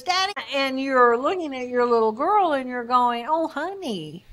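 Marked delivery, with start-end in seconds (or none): 0.0-0.3 put-on higher, child-like call of Daddy; a lightly voiced little daughter calling out; little girl calling. Little girl runs in to her father, light child voice. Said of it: "your little girl comes running in and, um, says". 3.3-4.2 softer, tender Oh honey; a gentle father greeting his upset daughter; compassionate father. Retold or imitated. Father turns to his crying daughter, soft tender voice. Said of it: "You immediately move into your compassion".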